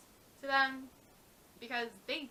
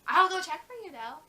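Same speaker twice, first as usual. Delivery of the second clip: overly helpful; 6 semitones higher, 10 dB louder than usual. Retold or imitated. Retail worker voiced, customer scene.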